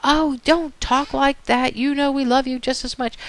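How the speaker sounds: high-pitched parental protest; acted placating mom or dad. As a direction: play a parent reassuring an upset child. Parent answers the protesting child.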